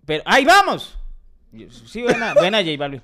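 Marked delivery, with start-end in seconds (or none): 0.3-1.0 high, loud cry on "ay", then "vamos"; AY of complaint. Shown as a demonstration. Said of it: "de queja, de ay".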